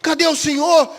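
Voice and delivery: Cadê o Senhor? cried out high and loud. Acted. The question repeated with more force.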